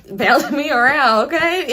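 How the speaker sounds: loud, high, laughing through it; cracking herself up; laughing joke. Real, not acted. [name] joking to viewers about the boy, laughing.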